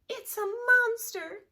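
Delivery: very high, loud mock fright; a mama bear squealing in alarm; high loud scared cry; scared mother bear. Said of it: "said Missus Blair".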